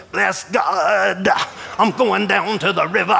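preacher voice. Retold or imitated. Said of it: "and he'd go".

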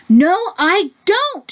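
high, stretched-out mock shout; hammed-up shout without real volume; storybook shout. A narrator's reading. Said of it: "shouted the Big Smelly Bear".

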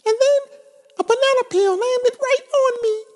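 very high, fussy little bird voice. Acted. Oxpecker telling her love about the banana peel, high and fussy. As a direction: play the upset little oxpecker.

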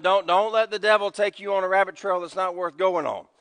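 ordinary talk

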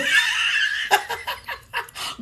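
high-pitched airy laugh fading out. Real, not acted. Host laughing at Giona's comment, high squeal.

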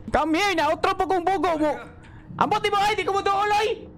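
real fright pushing the voice up; scared high yelling. Real, not acted. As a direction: panicky high-pitched protest at the game. Scared while playing horror game.

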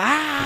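high-pitched reenacted yell; grito. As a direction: let out a high "aah" as a mimed scream. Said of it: "y gritaba". (grito agudo, imitado)

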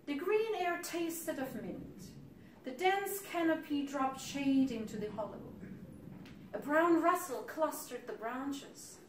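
lifted, lyrical recital; poem reading. Acted. Poet recalls monarchs wintering in the grove, lifted lyrical recital.

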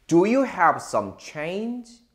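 slow, stretched model reading; slow careful reading. Shown as a demonstration. Teacher reads the sentence slowly for students to copy. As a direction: read it slowly word by word. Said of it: "발음 한 번 더 읽어볼게요".

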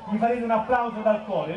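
normal talking voice

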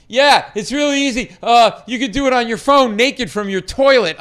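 loud, high, over-eager pitch; nervous overselling, high and loud; flustered babbling. Retold or imitated. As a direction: babble on in a starstruck panic. Re-enacts his babbling pitch to star.

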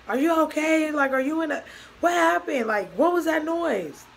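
loud, high, urgent questions; panicked questioning. Retold or imitated. Girlfriend asks about the crash noise, acted panicked questioning. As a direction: re-enact frantic alarm, voice pushed up. Alarmed caller after the crash.

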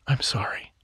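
soft unvoiced whisper. A narrator's reading. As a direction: breathe the apology. Tender remembered apology.

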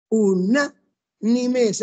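"Unánimes" very slow, broken into syllables; slow syllable division. Shown as a demonstration. Teacher showing an esdrújula, "Unánimes" split slowly into syllables. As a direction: pull "Unánimes" apart beat by beat. Teacher sounds out word for accents.

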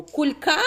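КУЛЬКА said as кульКА, last syllable pushed up; wrong stress on second syllable. Shown as a demonstration. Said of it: "А чого на другому?". (наголос на другому складі: «кульКА?»)

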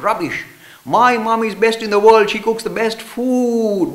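loud, high, slowed boast; indignant boy. Retold or imitated. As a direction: shout back the brag. Second boy retorting loudly.

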